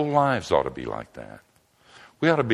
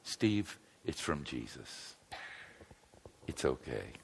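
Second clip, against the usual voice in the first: much softer, slowed, gentle hush; soft retold whisper; 7 semitones lower, 15 dB quieter, slower than usual. Nurse hugging the scared patient, soft gentle hush. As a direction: murmur it close to his ear to comfort him. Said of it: "she whispered in my ear".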